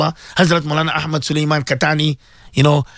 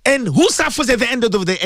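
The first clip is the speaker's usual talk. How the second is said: ordinary talk, nothing marked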